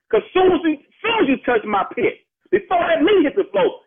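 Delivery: ordinary talk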